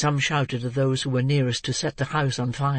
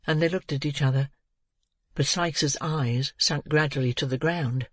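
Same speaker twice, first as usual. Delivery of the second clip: ordinary delivery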